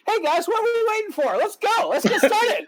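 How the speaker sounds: suddenly all cheer, let's go; cheerful upbeat. Retold or imitated.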